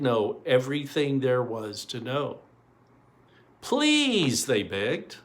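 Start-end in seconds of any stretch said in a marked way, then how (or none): 3.6-4.4 high, drawn-out plea; begging kids. Acted. Children beg the storyteller, high pleading voice.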